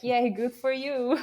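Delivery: sarcastic high, loud "yay, good for you"; mock cheer. Mocking proud half-Hungarians with high, loud fake cheer. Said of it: "I'm like".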